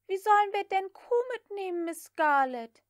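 high, eager maid's voice; young maid Prissy. A narrator's reading. Prissy explains the cow to Scarlett in a high voice. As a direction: play the chattering servant girl.

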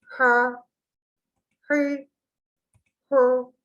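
"Ha, hı, hu" breathed from mid-throat. Shown as a demonstration. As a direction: push "Ha, hı, hu" from the middle of the throat. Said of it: "Boğazın tam ortası". (boğazın ortasından "Ha, hı, hu")

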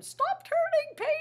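high, drawn-out monster plea; Grover voice. Shown as a demonstration. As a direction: do Grover for the kids. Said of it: "make a Grover voice like that".

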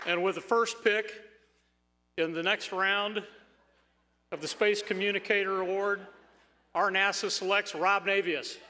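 loud, high announcer voice; announcer call. Real, not acted. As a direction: call it out like a draft pick. Announcing the award pick.

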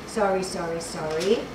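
low, soft repeated apology; muted apology. Real, not acted. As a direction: murmur it while distracted by searching. Apologising while searching for the verse.

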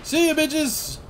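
high, loud victory whoop; gleeful whoop. Real, not acted. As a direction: cheer wildly as you escape. Taunting enemies in game.

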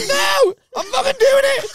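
very high, hammed-up shouting; mock hysterical shout. Festival weirdo refusing to be stopped, mock hysterical shout. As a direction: shriek it like a frantic weirdo.

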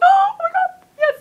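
very high, loud squeal; super excited. Retold or imitated. Kid told yes about the tabs, super excited. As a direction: shriek it with retold delight. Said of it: "I was, like, super excited".

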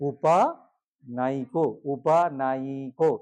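উপানায়িকো slowly with a ya glide; slow careful pronunciation. Shown as a demonstration. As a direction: model উপানায়িকো syllable by syllable. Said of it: "একটু কায়দা করে বলতে হবে". (ধীরে উপানায়িকো)

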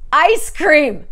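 loud, overbright "ice cream"; forced delight. Retold or imitated. Telling her date she loves ice cream, loud fake cheer. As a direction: sell a fake excitement.

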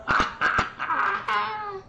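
very high cackle; high, gleeful burst of laughter; gloating cackle. Real, not acted. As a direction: cackle at an enemy's blunder. Gloating over opponent's mistake.

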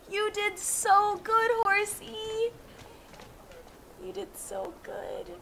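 slow, soft coo to a pet; tender praise. Real, not acted. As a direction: praise the horse gently. Praising the horse.

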